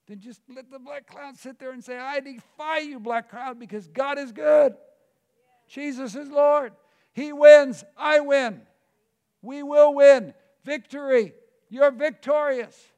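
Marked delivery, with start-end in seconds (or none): 2.0-12.9 much higher and louder, shouting out victory; defiant shout. Shown as a demonstration. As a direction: declare it at full voice against the cloud. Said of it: "just shout that kind of stuff".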